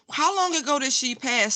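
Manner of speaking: ordinary delivery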